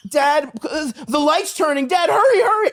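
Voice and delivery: put-on high-pitched pleading child; impatient kid. Kid nagging dad as the light turns, high urgent pleading.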